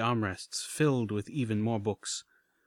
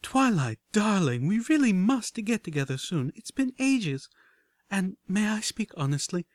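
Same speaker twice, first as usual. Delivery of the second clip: high, airy society lady; elegant posh voice; 8 semitones higher, 3 dB louder than usual. A narrator's reading. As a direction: gush like a grand lady. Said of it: "an elegant voice floated out".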